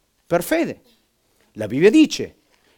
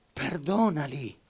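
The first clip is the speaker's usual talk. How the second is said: slow, much softer and drawn out; slow soft plea; 7 dB quieter, slower than usual. Retold or imitated. Preacher repeating Stephen's last plea, slowly and soft. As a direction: linger on it like a final sigh.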